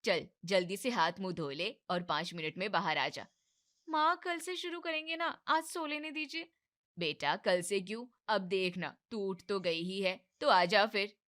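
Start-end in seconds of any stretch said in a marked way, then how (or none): 3.9-6.5 higher drowsy plea; sleepy pleading wife. Acted. Wife begs mother-in-law to let her sleep, higher and pleading. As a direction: beg for more sleep.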